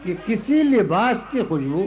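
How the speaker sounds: poem recital. Acted. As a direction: savour each line for the audience. Recites third qita.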